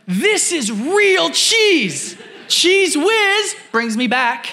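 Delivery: high, slow, savoured nostalgic shopper voice; imitating the shopper. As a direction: stretch it out like a man tasting memories. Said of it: "He said".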